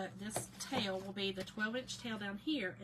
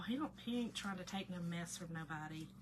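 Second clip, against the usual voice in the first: ordinary talk, nothing marked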